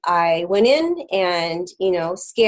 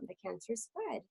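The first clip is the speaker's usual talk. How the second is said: hushed, very soft retelling of a whisper; retold whisper; 3 semitones higher, 20 dB quieter than usual. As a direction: drop to a near-whisper, as if into an ear. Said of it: "he whispered in my ear".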